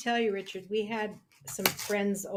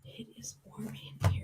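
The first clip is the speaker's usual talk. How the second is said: breathy whispered line; 8 dB quieter, faster than usual. Retold or imitated. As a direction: whisper it softly. Quoting a film line.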